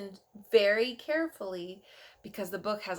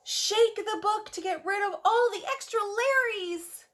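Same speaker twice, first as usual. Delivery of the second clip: excited instruction; 10 semitones higher than usual. Acted.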